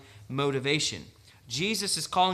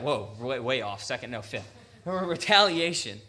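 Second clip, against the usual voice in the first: ordinary talk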